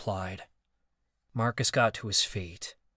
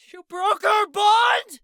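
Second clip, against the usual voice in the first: much higher and louder scream; 10 dB louder, slower than usual. Acted. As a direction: scream it in disbelief. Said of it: "Marcus screamed".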